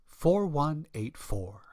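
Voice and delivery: ordinary talk, nothing marked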